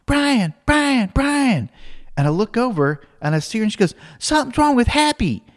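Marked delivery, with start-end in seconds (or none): 0.1-1.7 high-pitched mock child's yell; imitated urgent kid calling for help; Alex yelling out. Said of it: "I hear Alex yell out". 4.2-5.4 high-pitched imitation of a worried little girl; mock kid raising the alarm; Alex. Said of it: "I hear Alex yell out".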